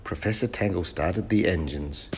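ordinary delivery, nothing marked

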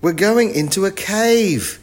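loud, high urgent warning; yelled warning. A narrator's reading. Wilf warns of the cave, high loud yell. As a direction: yell it out, pitch held high. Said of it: "yelled Wilf".

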